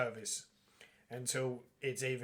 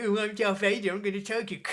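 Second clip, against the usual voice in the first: higher, louder mock Vader; taking the piss; 6 semitones higher, 5 dB louder, faster than usual. Admirals taking the piss out of Vader, mocking. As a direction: send him up as a whiny braggart. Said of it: "where they all just take the piss".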